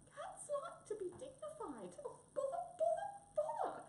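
very high, squeaky distress; upset mouse squeak; tearful little mouse. Acted. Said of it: "crying into a handkerchief".

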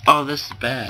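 ordinary talk, nothing marked

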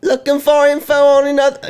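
put-on high girly voice; squeaky high game girl; high character voice. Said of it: "who wants to voice this chick".

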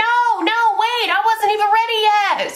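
high-pitched mock panic, not ready; retold panic. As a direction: squeal it like the bed caught you off guard. Bed lights up too early.

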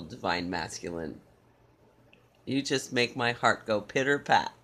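ordinary talk, nothing marked

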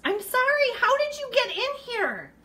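high, loud pleading squeal; high-pitched apology. Real, not acted. Woman apologising to the trapped mouse, high-pitched pleading. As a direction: squeal it at a tiny animal.

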